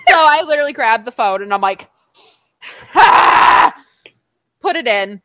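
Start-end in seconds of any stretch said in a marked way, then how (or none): 2.9-3.7 sharp unvoiced frustrated yelp; frustrated cry. Grabbing the phone from her friend with a frustrated cry. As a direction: let out a frustrated ah.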